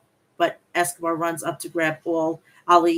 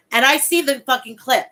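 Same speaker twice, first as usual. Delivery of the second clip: louder, punching the word see; loud emphatic; 4 semitones higher, 7 dB louder than usual. Real, not acted. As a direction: blurt it out, fired up. Excitedly retelling a funny clip.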